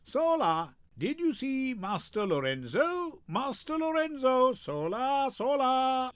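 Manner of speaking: high ringing calls; hollering. Acted. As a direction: yodel out the name to find him. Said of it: "Leave hollowing".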